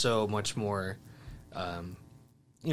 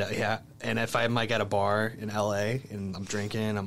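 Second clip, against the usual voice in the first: normal talking voice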